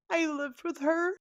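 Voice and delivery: high tearful voice; crying. Real, not acted. Daughter recalling life with her mom, crying. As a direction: push the words out through tears. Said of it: "sorry for crying like this".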